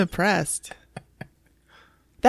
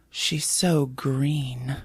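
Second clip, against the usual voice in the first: put-on slow, hushed kid in awe; awed child voice; 6 semitones lower, 4 dB quieter, much slower than usual. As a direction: draw it out like a fascinated little boy. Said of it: "Like that".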